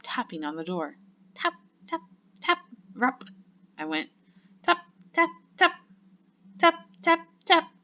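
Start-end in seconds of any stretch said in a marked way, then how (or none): 1.4-3.2 high, staccato knocking imitation; tapping sound. A narrator's reading. 4.6-7.7 high, loud staccato taps; tapping sound. A narrator's reading. Imitates tapping on the door.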